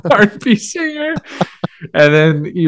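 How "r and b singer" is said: high, laughing squeal; quoting a line while losing it; cracking up. Real, not acted. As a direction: say it through helpless laughter.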